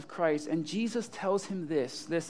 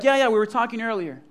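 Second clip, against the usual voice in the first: quick, louder, offhand reply; breezy confirmation; 3 semitones higher, 7 dB louder, faster than usual. Acted. Imagined Jesus answers shocked Peter, quick and breezy. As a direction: toss it off as no big deal.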